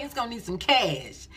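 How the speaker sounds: normal talking voice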